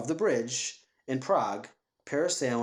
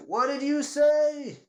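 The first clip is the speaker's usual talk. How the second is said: much higher, louder, slow squeal; mock high-pitched what did you say; incredulous squeak; 13 semitones higher, 5 dB louder, slower than usual. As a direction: squeak it out in disbelief. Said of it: "they're like".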